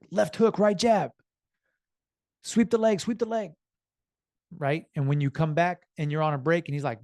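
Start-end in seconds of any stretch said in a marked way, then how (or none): 0.1-3.5 higher, louder coaching calls from the corner; corner man yelling. Retold or imitated. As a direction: bark orders at your fighter.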